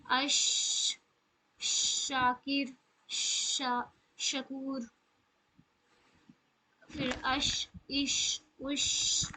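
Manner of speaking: اش breathed out long with the ش spreading, شاکر slow; drawn-out hissing ش; spread sh sound. Shown as a demonstration. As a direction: let the ش in اش spread fully. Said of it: "آپ کو محسوس ہوگا کہ".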